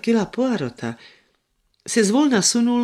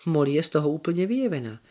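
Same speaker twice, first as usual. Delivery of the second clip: plain reading voice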